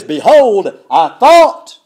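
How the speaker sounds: loud, angry outburst from Naaman; enraged shout. Retold or imitated. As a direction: bellow it in offended fury. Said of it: "He goes into a rage".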